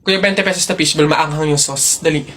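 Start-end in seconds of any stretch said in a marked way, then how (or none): none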